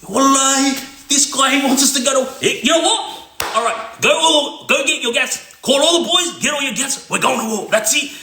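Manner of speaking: high, heated commands; mock-angry call to arms; imitated furious elder; declaring war.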